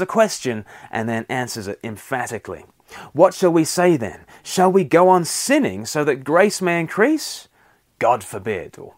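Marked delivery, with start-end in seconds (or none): 3.1-8.8 scripture reading. Acted. Reads Paul's question and answer aloud.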